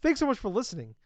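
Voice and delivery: punched-up thanks to listeners; deliberate clean retake. Real, not acted. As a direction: say it over brightly and firmly. Clean retake after fumbling the sign-off.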